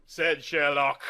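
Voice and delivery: ordinary delivery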